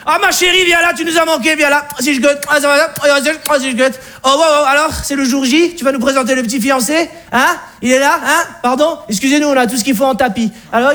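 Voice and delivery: high-pitched caricature of an Alsatian father. Acted. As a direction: play a fussy provincial father-in-law with an Alsatian twang. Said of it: "Le père alsacien nous aperçoit".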